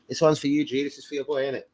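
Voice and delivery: ordinary talk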